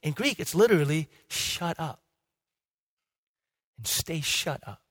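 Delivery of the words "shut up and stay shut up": quiet, low-key command, drawn out at the end; unpostured command. Retold or imitated. Jesus addressing the storm, quiet and unpostured. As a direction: give the order softly, without any show. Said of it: "There's no posturing".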